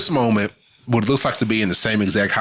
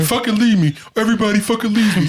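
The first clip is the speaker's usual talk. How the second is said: mock anguished rant; 11 semitones higher, faster than usual. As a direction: wail it out like he's falling apart. Acting out the father's breakdown.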